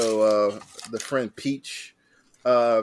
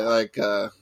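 ordinary talk

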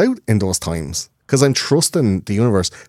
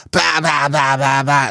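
put-on drunk babble; 6 semitones higher, slower than usual. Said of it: "they go".

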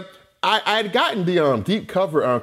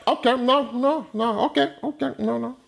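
high, nervous okays with a laugh; startled backing off; 9 semitones higher than usual. Retold or imitated. Felix dodging the fork, high nervous laugh. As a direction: back off fast, half laughing. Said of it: "and Felix like".

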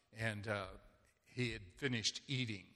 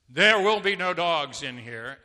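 mock stern declaration; 4 semitones higher, 11 dB louder than usual. Guy in meme declares rule.

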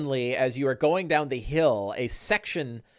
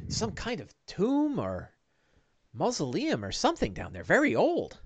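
soft, high, swooping voice; hushed high-voiced helper; awed sidekick Bing; 5 semitones higher, 6 dB quieter than usual. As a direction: puzzle it out quietly up high. Game master plays Bing.

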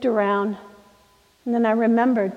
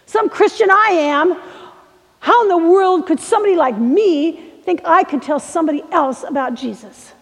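acted outburst at oneself, loud and high; bitter self-reproach; 6 semitones higher, 5 dB louder than usual. Young man cursed out of a house, berating himself. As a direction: berate yourself, voice raised.

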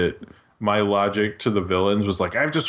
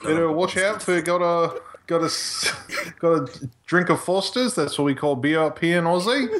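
higher-pitched cartoon Aussie accent with rising ends; hammed-up Australian impression; 6 semitones higher than usual. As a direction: put on a broad fake Australian twang. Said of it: "I can do an Australia".

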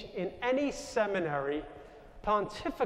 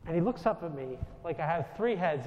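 normal talking voice

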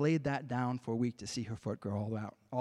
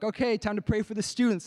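leader calling out; 7 semitones higher, 5 dB louder than usual. Retold or imitated. Leaders calling people to pray.

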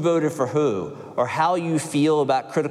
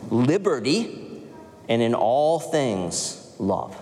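slow emphatic; 3 semitones lower, much slower than usual. Real, not acted. Slow closing of the creed.

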